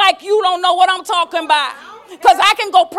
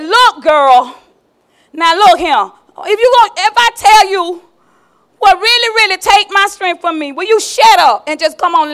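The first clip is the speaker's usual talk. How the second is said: louder, fed-up pleading; exasperated Samson; 6 dB louder than usual. Acted. Samson, worn out by nagging, louder and exasperated. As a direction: snap at her to drop it.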